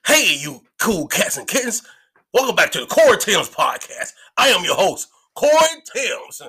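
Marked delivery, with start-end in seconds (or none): none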